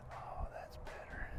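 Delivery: soft relieved whisper. Real, not acted. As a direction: breathe it out quietly.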